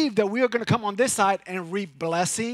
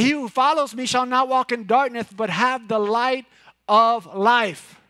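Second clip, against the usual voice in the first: higher and louder, with "follows" punched; louder, emphatic repeat; 5 semitones higher, 7 dB louder than usual. Shown as a demonstration. Pastor repeating the verse to the congregation, louder and emphatic. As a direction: proclaim it fully the second time. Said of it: "who, who does what?".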